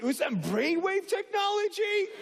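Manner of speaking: high-pitched incredulous question; hammed-up wonder; mock awe. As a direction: squeak it like an amazed passenger. Baffled passengers' question acted out.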